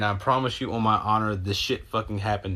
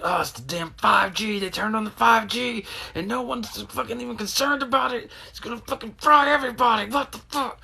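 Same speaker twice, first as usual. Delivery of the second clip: much higher, squeaky hammed-up alarm; mock paranoid rant; 13 semitones higher than usual. Hearing the window plink, a high mock-panicked rant. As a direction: play a frantic conspiracy nut.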